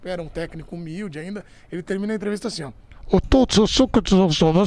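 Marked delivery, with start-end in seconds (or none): 3.1-4.7 much louder, right up against the microphone; mouth glued to the mic. Retold or imitated. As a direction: boom into the mic from too close. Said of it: "a pessoa tá colada assim no microfone".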